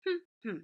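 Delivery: two short separate hums; closed-mouth hmm, hmm with a gap; humming. Shown as a demonstration. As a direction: hum one beat per syllable, twice. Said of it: "Hum the word".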